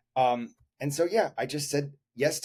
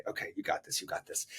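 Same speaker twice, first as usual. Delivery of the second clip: soft unvoiced self-encouragement; whispered pep talk; 9 dB quieter, faster than usual. To himself before the audition, a whispered pep talk. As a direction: whisper it to yourself to calm nerves. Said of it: "I'm just looking at the sheet music and I say".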